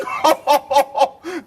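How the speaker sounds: high merry chuckle; a merry laugh wishing everyone well; jolly laugh. Real, not acted. Merry laugh before Christmas greeting.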